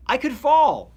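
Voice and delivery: loud, high mock alarm; acted fearful. Old person fearful of falling, loud mock alarm. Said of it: "you get fearful. You're like".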